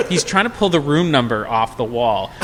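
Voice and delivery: ordinary talk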